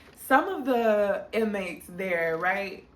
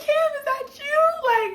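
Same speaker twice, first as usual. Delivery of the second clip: high, loud, slow squeal of delight; thrilled disbelief; 5 dB louder, slower than usual. Retold or imitated. Regina hearing Cam through the vent, high excited squeal. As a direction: gasp out his name, overjoyed.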